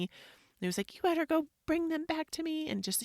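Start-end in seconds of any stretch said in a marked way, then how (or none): 0.9-2.7 high, bossy mock-child demand; mad kid. Son ordering mom to fetch his gear, mock mad. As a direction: imitate a cross kid ordering mom about. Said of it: "he was so mad at me".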